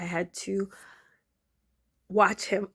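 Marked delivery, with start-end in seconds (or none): none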